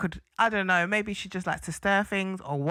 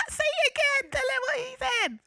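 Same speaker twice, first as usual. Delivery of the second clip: much higher, giggly mock egging on; laughing mock stirrer. Co-host mimicking the girl egging Harriet on, giggly and high. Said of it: "She was like".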